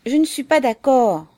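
high, loud protest; exclaimed objection. A narrator's reading. Fédia objecting to his comrades, crying out. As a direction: cry out in disagreement. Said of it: "s'écria Fédia".

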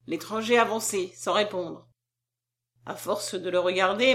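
usual speaking voice

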